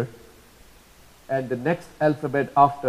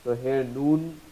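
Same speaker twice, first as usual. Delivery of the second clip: ordinary talk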